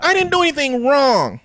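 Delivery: put-on high, protesting teen-girl voice.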